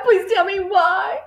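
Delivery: high, slow, breathless plea; distraught girl's drawn-out plea; pleading cry. A narrator's reading. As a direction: beg your teacher for the reason. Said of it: "Matilda cried".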